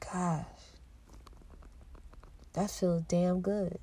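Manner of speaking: slow, soft, contented sigh of words; quiet satisfaction. Real, not acted. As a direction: let it sink in, softly. Savouring her new beginning.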